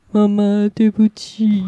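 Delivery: mother imitating her child's drawn-out little apology; apologetic little child. Child says sorry to mother at bedside, imitated contrite child voice. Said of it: "然后滚到我旁边说".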